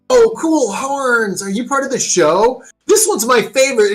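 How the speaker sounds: put-on excited little kid. GM voicing an awestruck kid meeting the heroes, high and gushing. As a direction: gush like a starstruck kid at a show. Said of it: "a little kid comes up to you and goes".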